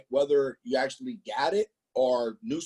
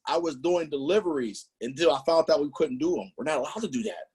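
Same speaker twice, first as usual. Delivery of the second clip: normal talking voice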